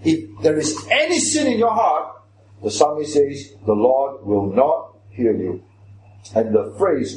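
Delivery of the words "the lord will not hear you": slow, low and weighty on NOT; "not" set out slowly and firmly; solemn emphasis. Shown as a demonstration. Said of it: "the same kind of strength of emphasis".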